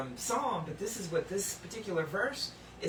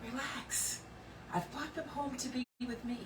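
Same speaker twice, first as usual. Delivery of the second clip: soft, gentle voice of God; tender reassurance; 5 semitones higher, 8 dB quieter than usual. Acted. God gently comforts the grieving, softly reassuring. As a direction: hush them softly, like comforting a grieving child. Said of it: "And God says".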